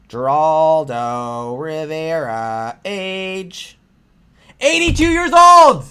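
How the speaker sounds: slow mock announcement building to a shout; slow mocking intro rising to a shout; mock announcer. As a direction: drag it out like a mock game-show intro, then yell. Mocking announcement of his age.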